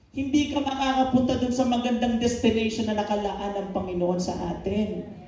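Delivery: normal talking voice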